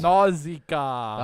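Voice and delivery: NAUSICAA loud and drawn out; playful booming. Pretend. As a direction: belt out Nausicaa, stretching it long. Playfully booming out the hard title.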